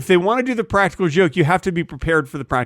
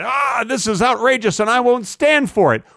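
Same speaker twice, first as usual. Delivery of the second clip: higher-pitched put-on outrage; mock angry yell; 6 semitones higher than usual. Comedian acting out angry travelers in public, mock outraged yell. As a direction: act out a public complaint, mildly overblown. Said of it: "they start yelling and they say".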